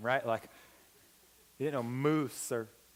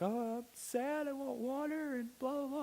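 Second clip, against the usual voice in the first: mock whining; 11 semitones higher, 6 dB quieter than usual. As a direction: whine like a sulky kid, hammed-up. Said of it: "complaining about it every step".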